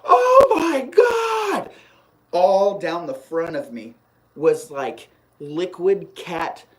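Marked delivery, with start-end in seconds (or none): 0.0-1.7 very high, loud, slow drawn-out shriek of disgust; horrified mom. Retold or imitated. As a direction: shriek it slowly like a horrified mother. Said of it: "And she's like".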